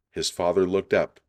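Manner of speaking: ordinary delivery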